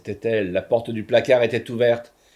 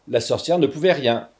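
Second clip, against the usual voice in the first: plain reading voice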